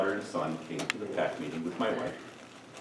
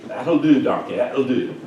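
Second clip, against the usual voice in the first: a loud, hammed-up "that'll do" movie quote; tossing off a movie catchphrase to say the makeup is fine, booming; mock movie farmer; 2 semitones higher, 10 dB louder, slower than usual. Loud joking movie-line delivery.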